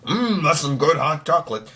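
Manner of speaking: hammed-up gravelly grumble with growled hums; grumbliest voice. Host mocking a hunter who is no villain, grumbly voice. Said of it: "the most grumbliest voice".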